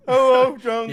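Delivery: Sloth from Goonies. Retold or imitated. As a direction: mimic the lovable brute calling his friend. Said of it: "he's coming off like Sloth from Goonies".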